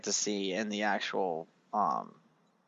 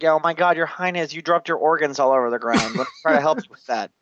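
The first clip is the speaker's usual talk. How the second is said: higher-pitched mock alarm, rushing at the end; imitated fawning servant; 6 semitones higher, faster than usual. Peggy offering the king help with dropped organs, mock alarm. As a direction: fuss over royalty in imitation. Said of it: "Peggy does the stupid mistake".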